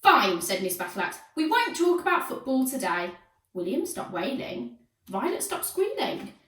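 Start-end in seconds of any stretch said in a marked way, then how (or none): 0.0-0.4 exasperated teacher. A narrator's reading. Teacher gives up on noisy class. 1.3-3.1 stern teacher. A narrator's reading. Teacher punishes noisy class.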